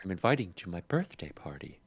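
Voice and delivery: hushed unvoiced whisper. Real, not acted. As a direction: whisper it as a secret aside. Whispered joke aside.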